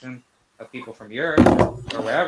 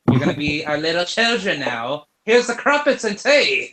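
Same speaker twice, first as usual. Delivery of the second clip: hammed-up British accent, high and loud; mock British accent offering tea; terrible British accent; 4 semitones higher, 7 dB louder than usual. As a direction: put on a bad posh British accent. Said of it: "that was a terrible accent".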